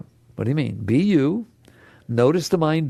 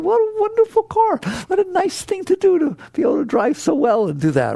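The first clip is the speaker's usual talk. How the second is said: higher, beaming thanks to the car; grateful praise; 11 semitones higher than usual. Acted. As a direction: gush thanks to a beloved old car. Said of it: "I said".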